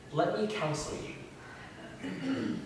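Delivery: hushed, very slow tender offer; comforting counsel. Acted. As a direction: whisper it like a tender invitation. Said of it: "comforting and counseling way".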